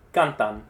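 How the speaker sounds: Cantan said low as CAN-tan; first-syllable stress. Shown as a demonstration. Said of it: "I'm going to say a word".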